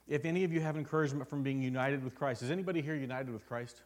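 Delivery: loud, rising call to the congregation; full-force preaching. Acted.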